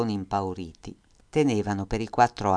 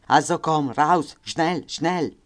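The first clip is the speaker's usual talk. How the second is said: high, loud barked German orders; guard shouting in German at the prisoners; 7 semitones higher, 6 dB louder, much slower than usual. Acted. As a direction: hurry them out harshly.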